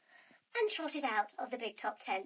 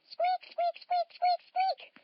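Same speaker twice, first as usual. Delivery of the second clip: high squeaky mouse noises; mouse squeaks; 4 dB louder, slower than usual. Acted. Mouse squeaking in children's story.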